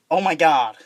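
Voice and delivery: mock-American exclamation; shitty American accent. Shown as a demonstration. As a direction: pull off a bad American accent. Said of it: "try and pull it off".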